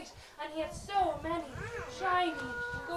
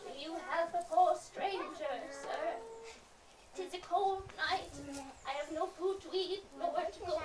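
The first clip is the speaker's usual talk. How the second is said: put-on weak old beggar; 4 dB quieter than usual. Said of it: "a low, gruff, weak voice".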